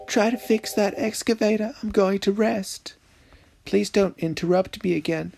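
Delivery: put-on high, soft tired girly voice; man doing a woman's voice. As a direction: play the sleepy sister. Voicing sister's dialogue.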